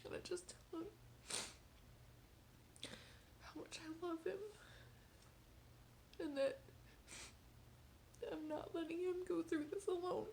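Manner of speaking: real crying. Real, not acted. Mother tearing up recounting.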